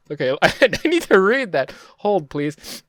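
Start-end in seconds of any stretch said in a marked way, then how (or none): none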